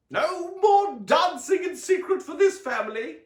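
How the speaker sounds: Aunt Summer cheers the family on, high loud exclaim. A narrator's reading. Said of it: "Aunt Summer exclaimed".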